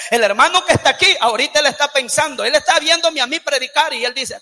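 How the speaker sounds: ordinary talk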